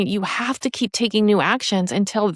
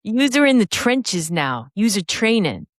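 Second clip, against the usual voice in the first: put-on slow, swaggering Boston accent; blunt Boston-accented put-down, drawn out; slower than usual. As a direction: imitate a tough Boston coach. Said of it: "swagger in his Boston accent".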